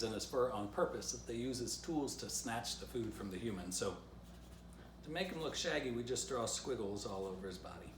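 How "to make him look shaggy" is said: ordinary delivery, nothing marked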